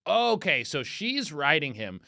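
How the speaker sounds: ordinary delivery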